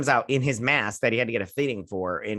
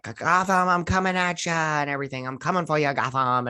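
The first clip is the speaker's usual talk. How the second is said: Joker; 4 semitones higher than usual. Retold or imitated. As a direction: imitate a badly acted villain calling out to the city. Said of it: "they've turned him into the new Joker".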